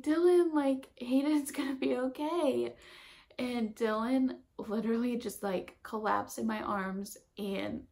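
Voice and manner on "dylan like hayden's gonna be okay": excited relief. Retold or imitated. Telling partner baby is okay.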